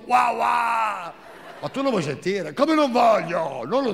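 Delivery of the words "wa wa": imitating baby babble. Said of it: "Ma io ho sentito".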